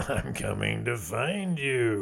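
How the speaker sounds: slow, drawn-out spooky voice; scary voice. Retold or imitated. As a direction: stretch it out like a lurking monster. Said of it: "Grandpa coming with his scary voice".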